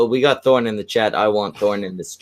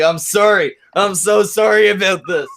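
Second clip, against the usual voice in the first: higher, hammed-up pleading apology; mock distraught apology; 8 semitones higher, 4 dB louder than usual. Voicing a Canadian apologizing to the crying stripper, mock distraught apology. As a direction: wail an apology in mock anguish.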